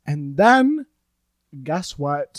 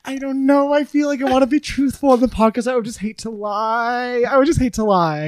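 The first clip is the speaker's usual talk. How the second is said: high, whiny-coy protest; mock reluctance; 7 semitones higher than usual. Storyteller torn about being truthful on the podcast, mock reluctance. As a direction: agonize theatrically in a high voice.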